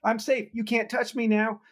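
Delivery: put-on high, singsong kid's tag taunt; mock kid voice playing tag; childish playground taunt. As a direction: tease like a child safe at base.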